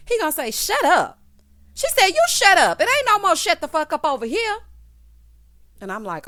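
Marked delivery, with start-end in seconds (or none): 0.5-1.2 loud, raised retort from a quarrel; retold angry snap. Husband silencing his wife in a retold row, loud and angry. 2.1-4.6 high, loud, heated retort; angry shouted comeback. Retold or imitated. Wife firing back at husband who said shut up, loud and high.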